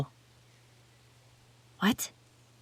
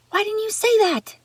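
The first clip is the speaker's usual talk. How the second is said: alarmed outburst; 3 dB louder than usual. Acted. Hears the searchers are near.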